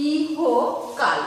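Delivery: ইহকাল slowly, each syllable drawn out; syllable-by-syllable pronunciation. Shown as a demonstration. Teacher putting the dictated parts together, syllable by syllable. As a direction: stretch ইহকাল into three clear parts. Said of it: "উচ্চারণে কি লিখছি?".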